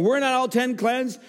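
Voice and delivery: high, slow, pointed question; Jesus asking in disbelief; astonished question. Acted. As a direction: demand where the others went. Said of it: "Jesus asked this question".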